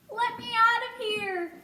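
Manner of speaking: high, drawn-out mouse plea; urgent plea. A narrator's reading. Trapped mouse to the woman, pleading. Caged mouse begging to be freed.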